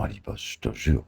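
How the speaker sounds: ordinary delivery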